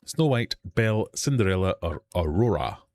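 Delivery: high, projected quizmaster announcement; game-show question read, starting high and stepping down; quiz host. Acted. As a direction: announce the question and options to the room.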